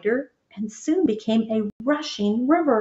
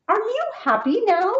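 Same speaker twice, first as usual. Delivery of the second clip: hopeful call; 11 semitones higher than usual. A narrator's reading. Bullfrog calls to captain.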